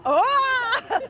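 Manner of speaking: high, slow, loud surprised oh; startled cry. Real, not acted. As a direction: caught off guard by the dinosaur. Dinosaur suddenly lunges at them.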